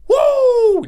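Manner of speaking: very high, loud wrestler whoop; full-throated wrestling woo; Ric Flair woo. Retold or imitated. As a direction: let out a huge ring-announcer woo. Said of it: "Just Ric Flair".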